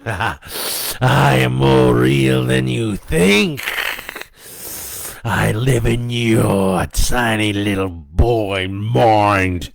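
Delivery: loud, slow demonic voice; menacing devil. A narrator's reading. Devil threatens to haunt the boy's dreams, menacing. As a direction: threaten slowly as a gloating devil. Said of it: "it laughed".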